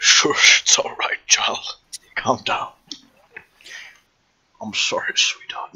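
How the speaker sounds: put-on whispered soft boy voice. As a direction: breathe it like a gentle soft boy. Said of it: "What's a soft boy voice then?".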